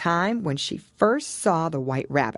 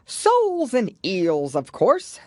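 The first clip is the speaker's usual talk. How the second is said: louder, slow, exasperated Gryphon; a mild impatient snap; 6 semitones higher, 5 dB louder, slower than usual. A narrator's reading. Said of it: "rather impatiently".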